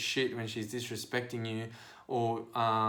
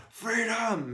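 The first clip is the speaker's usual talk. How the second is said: high, ringing shout; high mass battle cry; crowd's rallying cry; 9 semitones higher than usual. Retold or imitated. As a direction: cry it out like a whole stadium. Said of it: "three and a half thousand people in a Superdome went".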